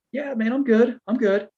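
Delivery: high, light "I'm fine" act; high-pitched reassurance. Retold or imitated. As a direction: brush off concern in a high voice. Retold brushing off concern over bite.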